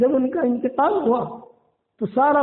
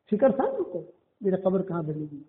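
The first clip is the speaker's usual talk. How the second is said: ordinary delivery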